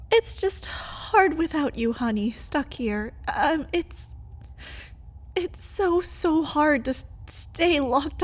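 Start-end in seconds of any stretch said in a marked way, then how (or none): none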